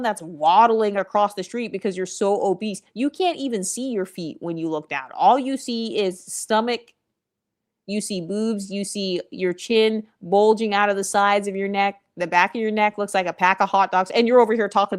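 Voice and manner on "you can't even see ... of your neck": usual speaking voice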